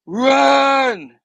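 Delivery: high, loud, stretched yell; drawn-out dramatic shout; acted urgent shout. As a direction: cry out a warning. Peter urging Norman to flee.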